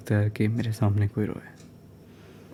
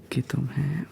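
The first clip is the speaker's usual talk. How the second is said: ordinary talk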